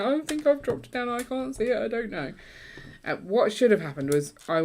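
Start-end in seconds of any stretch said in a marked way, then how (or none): 0.0-2.3 higher, panicky retelling; mock panic; freaked out. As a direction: fret about the lost print. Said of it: "'Cause you freaked out".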